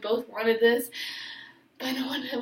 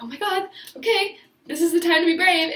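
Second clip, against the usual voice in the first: higher and louder, nervous excitement; psyching up; 6 semitones higher, 6 dB louder than usual. Retold or imitated. Working up courage to kiss him, excited and louder. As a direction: hype yourself up out loud.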